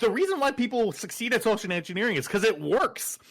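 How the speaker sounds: high, loud, animated protest; real worked-up protest; indignant self-defence. Real, not acted. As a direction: argue back heatedly. Defends self after being teased.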